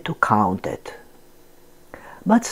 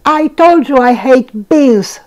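Donald voice; 8 semitones higher, 6 dB louder than usual. Acted. Donald voiced in read story.

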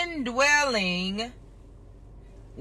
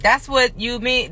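ordinary talk

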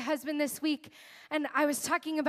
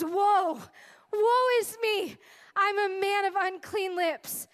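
awestruck prophet; 6 semitones higher, 5 dB louder, slower than usual. Retold or imitated. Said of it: "He says".

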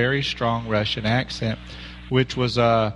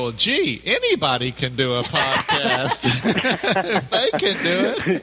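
laughing mock thought; 3 semitones higher than usual. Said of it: "you listen to our show and go".

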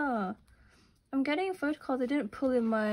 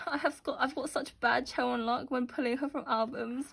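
ordinary talk

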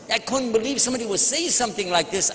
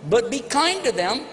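ordinary delivery

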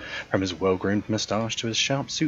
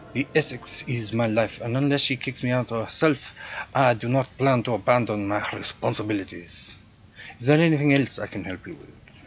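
put-on generic European accent; 3 semitones higher than usual. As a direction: play the proud hotel concierge with a thick generic European accent. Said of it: "my generic European accent".

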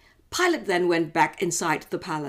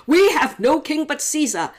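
loud, higher retort; priests answering; 9 semitones higher, 7 dB louder than usual. Acted. Chief priests to Pilate, a loud defiant retort. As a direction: fling it back at Pilate. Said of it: "the chief priests answered".

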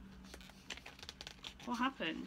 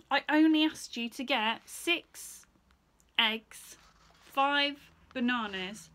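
loud, slow, pointed listing; cross slow list; 2 semitones higher, 8 dB louder, slower than usual. Acted. Mother lists the shopping crossly, loud and slow. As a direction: hammer each item home.

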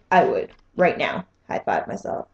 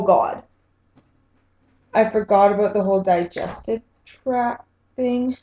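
ordinary delivery

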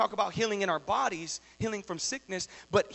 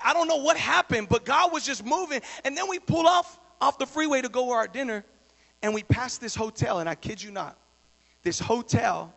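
ordinary talk

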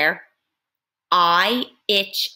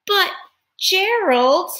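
calling out; 10 semitones higher, 4 dB louder, slower than usual. Acted. Piggy calls out to friend.

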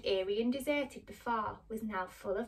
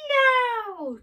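high, loud drawn-out cry; witch's shriek; 13 semitones higher, 12 dB louder than usual. Acted. Witch splashed with water, shrieking. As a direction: shriek it high and long, falling at the end. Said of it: "who began to shriek".

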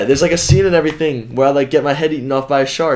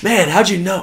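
put-on amazed kids; 8 semitones higher than usual. Retells amazed kids' reaction.

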